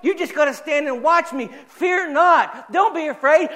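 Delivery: high, loud, ringing preacher's cry; full-force preaching. Acted. Preacher crying out a promise to his church, at full force.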